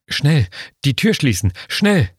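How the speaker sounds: rising panic, pitch climbing on the last word; frantic, hurried urging; panicked urgency. A narrator's reading. Hiding from pursuer, urgent thought.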